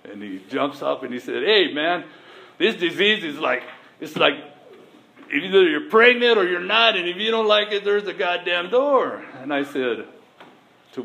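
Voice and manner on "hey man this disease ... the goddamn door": higher, louder retold shout at a newcomer; mock-yelling the old-timer's ultimatum; retold angry outburst. Oldtimer jumps up at meeting.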